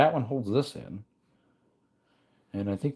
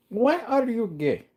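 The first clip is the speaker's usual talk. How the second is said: high, slow silly voice; meme voice; 13 semitones higher than usual. Pretend. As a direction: quote the meme in a goofy squeaky voice.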